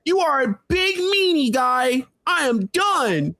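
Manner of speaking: high-pitched mock meltdown; mocking tough guy imitation. Man mimicking his opponent's crash-out threats, mocking. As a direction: parody a fed-up rival losing it. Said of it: "That's your tough guy impression".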